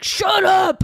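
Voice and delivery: high, loud mock scream; screamed shut up. Fed-up man yelling at a pest, screamed. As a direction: yell it like a fed-up man losing his rag. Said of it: "The way that Rick screams "shut up".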